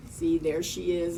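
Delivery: ordinary delivery, nothing marked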